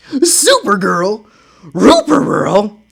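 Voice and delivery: slow dog-like R-speech; Scooby voice. Acted. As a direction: say it the way Scooby mangles words. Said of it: "I'm doing different voices for different people".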